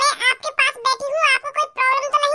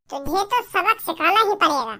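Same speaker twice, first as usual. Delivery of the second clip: scheming aside; 8 semitones lower than usual. Acted. Aside vow of revenge.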